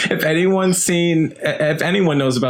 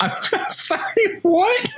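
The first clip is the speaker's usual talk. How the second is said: stunned disbelief. Real, not acted. Stunned by absurd plot twist.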